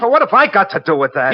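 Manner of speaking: high, alarmed protest; nervous cornered plea; panicked protest. Acted. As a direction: pushes back, frightened and shrill-high.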